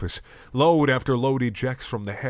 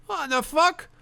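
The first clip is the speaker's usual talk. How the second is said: put-on high squeaky drunk outburst; shocked drunk; slower than usual. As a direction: shriek it in disbelief. Drunk in crowd reacting.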